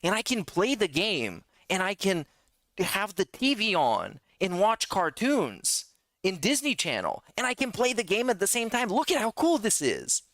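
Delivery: put-on high, eager twelve-year-old showing off; excited kid voice. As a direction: boast to Mom like an excited kid. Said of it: "And I would be like".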